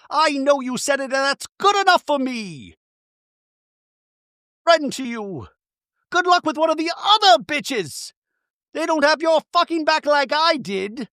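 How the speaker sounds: high-pitched mock outrage; Bronwyn, angry. Bronwyn rejects her friend's accusations, hammed-up anger. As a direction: throw it back high and indignant. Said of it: "And Bronwyn's like".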